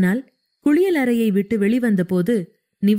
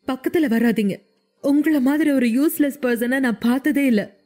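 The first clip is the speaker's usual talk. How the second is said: higher-pitched telling-off without real volume; understated, higher-voiced outburst; mild angry yell; 4 semitones higher than usual. A narrator's reading. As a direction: act out an upset woman pushing him away. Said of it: "என்று கத்தினாள்".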